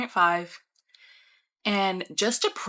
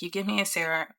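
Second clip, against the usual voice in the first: ordinary talk, nothing marked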